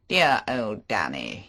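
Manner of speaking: slow, fond, drawn-out man; Favell. Acted. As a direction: linger over the name fondly. Favell voiced.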